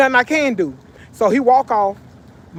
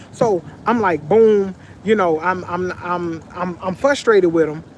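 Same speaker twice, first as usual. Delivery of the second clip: plain reading voice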